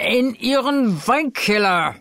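put-on drunken urging. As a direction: pull him along eagerly. Fortunato insists on the cellar. (ungeduldig drängend, betrunken)

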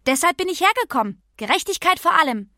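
keckes Kind, höher und lauter als die Erzählung; kecke Pippi. Acted. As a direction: sprich als freche, selbstbewusste Pippi. (freche Pippi-Stimme)

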